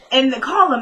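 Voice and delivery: ordinary delivery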